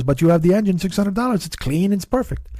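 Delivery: high-pitched imitation of a Swedish neighbor's accent; Oscar's accent. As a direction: put on the foreign accent, pitched well up. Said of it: "he talk like this".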